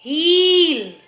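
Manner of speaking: "हील" with a long held ee; sustained ee in "हील". Shown as a demonstration. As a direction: draw out the vowel in "हील". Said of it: "हील बोला है तो उसमें बड़ी".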